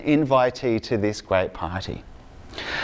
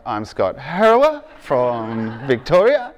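slow, drawn-out Australian accent, lightly played up; introducing himself at the door in an exaggerated Aussie accent; broad Aussie accent; slower than usual. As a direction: say your name in a thick Aussie accent, slowly. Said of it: "with my Aussie accent".

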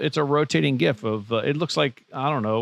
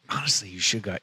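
whispering; 5 dB quieter than usual. Real, not acted. Conspiratorial suggestion.

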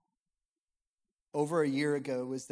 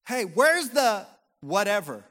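yelling across the room; 6 semitones higher, 10 dB louder, slower than usual. Retold or imitated. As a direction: call out loud and slow to the next room. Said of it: "you'll yell across the house".

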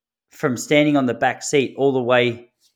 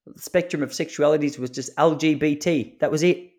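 ordinary delivery

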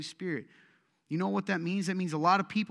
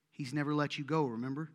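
usual speaking voice

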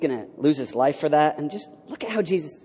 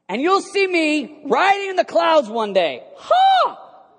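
loud, high, triumphant proclamation; thundering boast capped with a shouted "Ha!"; Jesus; 13 semitones higher, 6 dB louder, slower than usual. Acted. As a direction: shout it defiantly to frighten the accusers. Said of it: "scary moment where Jesus goes".

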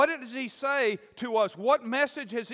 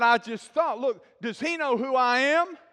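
indignant, louder retold complaint; angry Naaman; 4 semitones higher, 5 dB louder than usual. Preacher voicing proud Naaman, indignant. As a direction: huff like an offended general. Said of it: "he left angry".

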